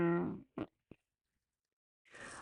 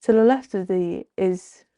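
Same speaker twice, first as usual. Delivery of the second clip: ordinary delivery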